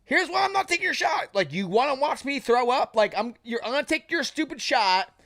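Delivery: high, fast, worked-up explaining; mock exasperated rant. Telling the heavyweight why he won't take the shot, fast and high. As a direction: rant fast and high like you can't believe him. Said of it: "I'm like".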